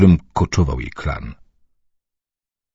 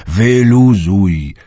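very slow, drawn out, louder; almost shouting; 2 semitones higher, 5 dB louder, much slower than usual. Acted. Uncle nearly yells at fretting Dunia to relax. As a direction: stretch "wyluzuj" into a loud plea. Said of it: "prawie wrzasnął".

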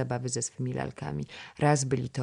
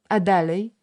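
put-on high, bright chatter of a small princess-girl, higher and louder; a chattering little girl coaxing the boy to play; little girl Krysia; 6 semitones higher, 7 dB louder than usual. (wysoki głosik małej dziewczynki)

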